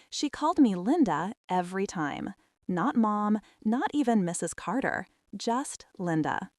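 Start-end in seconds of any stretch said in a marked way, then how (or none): none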